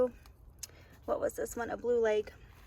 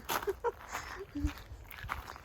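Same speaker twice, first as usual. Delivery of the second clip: breathy laugh; 8 semitones higher, 8 dB quieter than usual. Real, not acted. Sad garden reveal.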